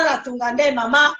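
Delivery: ordinary talk, nothing marked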